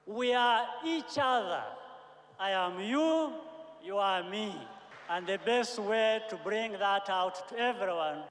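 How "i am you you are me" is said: ordinary talk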